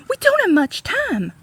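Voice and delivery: high, loud and urgent; urging. A narrator's reading. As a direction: press him to hurry. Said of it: "urged Catherine".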